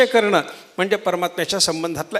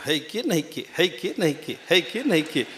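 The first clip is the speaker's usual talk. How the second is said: softer, lower donkey hee-haw chant; donkey's doubting wheeze; 3 semitones lower, 5 dB quieter than usual. Retold or imitated. Preacher imitating the donkey's softer doubting cry. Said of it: "हे त्याचं ओरडणं असतं म्हणे".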